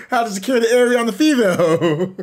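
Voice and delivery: laughing through the words; real laughing talk. Real, not acted. Joking about securing the area, laughing as he talks. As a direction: let the laugh spill into the line. Cracks up at own innuendo.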